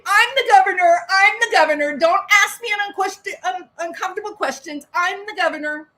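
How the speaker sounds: hammed-up governor yelling down reporters; mock screaming governor. The governor yelling at reporters, a mocking loud outburst. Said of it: "They start screaming at the reporter".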